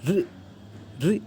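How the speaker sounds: ऋ with the tongue tip trilled behind the teeth; correct Sanskrit ṛ. Shown as a demonstration. Teacher demonstrating the correct Sanskrit ऋ. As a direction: vibrate the tongue on ऋ, a true vocalic r. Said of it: "जो कि कुछ ऐसा है".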